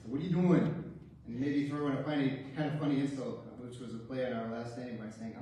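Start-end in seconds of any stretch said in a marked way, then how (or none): 0.1-0.8 frustrated dad. Retold or imitated. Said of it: "When he was frustrated".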